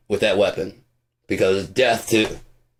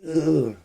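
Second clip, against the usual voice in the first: a heavy, higher groan of a body giving out; groggy ugh; 7 semitones higher than usual. Retold or imitated. Stoner voicing his body as sativa kicks in, a groggy groan. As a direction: groan like the drug just hit. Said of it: "my body is like".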